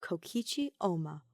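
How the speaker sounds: ordinary delivery, nothing marked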